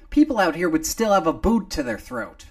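loud, fast, indignant; heated objection. Acted. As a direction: push back hard. McGrady objects.

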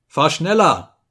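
loud, high command; urging the coachman. Acted. Narrator urging the coachman on, loud and high. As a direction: push the driver to hurry.

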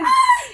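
high, loud squeal of delight; excited squeal. Real, not acted. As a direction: let out a real high-pitched excited shriek. Excited squeal about fall activities.

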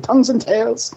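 laughing mock. Mocking the album title.

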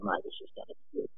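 a quick, breathless whispered aside. Retold or imitated. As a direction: hush it to yourself, like a secret. Aside before facing Richie Collins.

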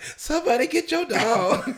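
high, loud comic cry for help; panicked yell. Retold or imitated. Runner fleeing a pit bull calls out to the owner, hammed-up panicked yell.

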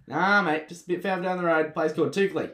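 loud, high mock Aussie accent. Local imitated sending a traveller on to Toukley, mock Aussie voice. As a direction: push the Aussie voice up and out. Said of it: "that's his Aussie voice".

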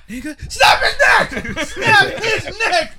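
high, loud, slow laughing yell; yelled quote. Retold or imitated. Replaying the sketch's punchline for friends, yelling and laughing. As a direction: drag out the frenzied order while cracking up. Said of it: "starts yelling to kill the guy".

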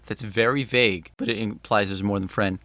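ordinary talk, nothing marked

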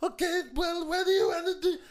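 put-on high whining girl; whiny girl voice. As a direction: whine it like a girl. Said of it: "she was like".

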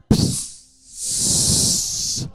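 unvoiced spray hiss; cartoon squirt noise; spurting sound effect. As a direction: make blood spit out.

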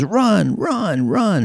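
high stadium yell; crowd yelling. Acted. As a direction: urge the runner on from the stands.

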